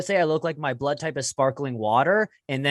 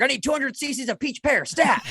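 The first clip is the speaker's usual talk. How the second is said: much higher urgent doctor voice; 11 semitones higher than usual. Pretend. Comic pitching a joke as a doctor in the ER, high urgent voice. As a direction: bark it like an ER doctor calling for supplies. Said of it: "the doctor would be like".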